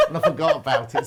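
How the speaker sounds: giggling through the words; laughing. Real, not acted. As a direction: laugh while confessing the mix-up. Laughing over swapped sanitizer.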